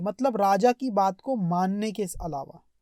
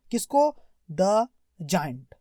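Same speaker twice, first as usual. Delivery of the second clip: usual speaking voice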